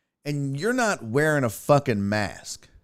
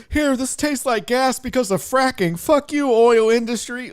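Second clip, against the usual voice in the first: mocking heckler; 8 semitones higher, 4 dB louder than usual. Comic voices angry water-thrower.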